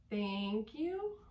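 whispered song line; whispering. Shown as a demonstration. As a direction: whisper the lyric softly. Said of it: "It goes".

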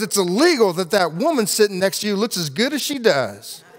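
preacher voicing the stranger's joke; street man voice. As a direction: pop it out as the man at the window. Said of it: "And he goes".

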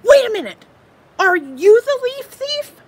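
high, fast, frantic little squirrel voice; excitable squirrel. Acted. Squirrel frets over his missing leaf, high and anxious. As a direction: play the squirrel, jumpy and loud.

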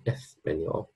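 "español" with a Castilian s, as in Spain; Spain accent. Shown as a demonstration. Teacher contrasts regions, "español" in a Spain accent. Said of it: "but in Spain, Spanish, we say".

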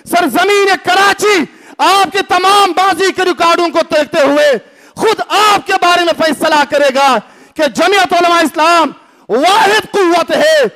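ordinary talk

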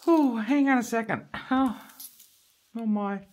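fighting back tears while talking; tearing up. Real, not acted. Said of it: "Sort of tearing up here now".

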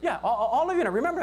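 ordinary talk, nothing marked

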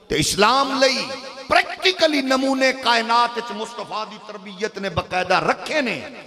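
loud, forceful sermon delivery; fiery preaching. Real, not acted. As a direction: preach it at full force to rouse the crowd. Preaches at full force, building to climax.

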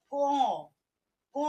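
ক said through the nose, nasalised vowel; nasal ক. Shown as a demonstration. Teacher showing children the nasal sound, nasal ক. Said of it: "তখন দেখো কিভাবে উচ্চারণ করছি".

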